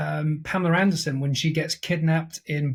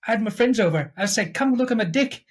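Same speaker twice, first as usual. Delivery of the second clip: higher-pitched South African advert voice; put-on South African accent, pitched up; 5 semitones higher than usual. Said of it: "it's a South African advert".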